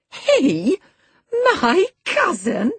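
very high, loud, slow disbelief; scornful cry. A narrator's reading. Girl mocking the idea of such a cousin, scornful high cry. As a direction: scoff at it in a girlish voice. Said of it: "cried Cathy with a scornful laugh".

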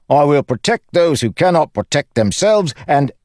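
high, jaunty joker's voice; breezy recruiter explaining the words; cheeky Lopen, instructing. Acted. Lopen's dialogue in narrated story.

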